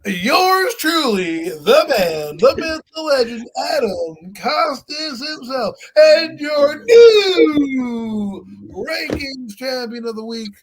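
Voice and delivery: high, loud, stretched-out champion intro; hype announcer shout. Acted. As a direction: boom it out like a ring announcer. Crowning himself weekly champion.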